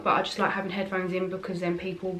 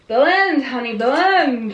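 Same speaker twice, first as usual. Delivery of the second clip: higher, louder American drawl on blend, stretched slow; playful American accent; 4 semitones higher, 8 dB louder, much slower than usual. Pretend. To her viewers while blending eyeshadow, a sudden loud hammed-up American accent. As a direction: ham up an American beauty guru, honey and all. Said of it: "randomly start talking in an American accent".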